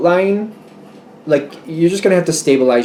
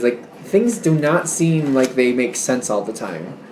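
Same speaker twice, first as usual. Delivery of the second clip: ordinary delivery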